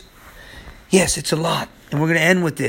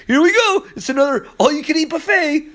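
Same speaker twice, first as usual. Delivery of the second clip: mocking onlooker; 11 semitones higher than usual. Mocking crowd voiced in sermon.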